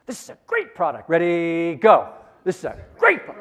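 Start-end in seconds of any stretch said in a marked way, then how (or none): 0.1-1.1 forced whisper, "great" punched louder and higher; forced whisper, emphasis. Shown as a demonstration. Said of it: "It's a forced whisper, emphasis on the word great". 2.4-3.3 forced whisper bursting loud on "great"; forced whisper, emphasis. Shown as a demonstration. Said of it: "It's a forced whisper, emphasis on the word great".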